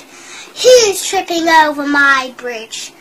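booming troll challenge; loud troll. Acted. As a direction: bellow it like a troll guarding. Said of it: "said the troll".

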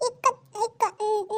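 ordinary delivery

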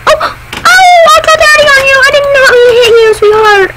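mock sobbing mother; crying shout. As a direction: wail through fake tears. Mother cries over child.

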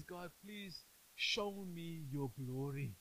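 slow, soft pleading prayer as Moses; hushed, drawn-out plea for glory. Retold or imitated. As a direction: beg God slowly and softly. Moses voiced pleading to God.

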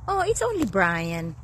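ordinary talk

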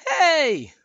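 high, loud ticklish squeal; high giggly cartoon voice; Olaf giggling. A narrator's reading. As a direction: exclaim high, as if being tickled. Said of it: "Olaf giggled".

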